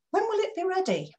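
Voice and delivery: pushy demand for a deadline; high impatient question. Shown as a demonstration. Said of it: "so many different ways".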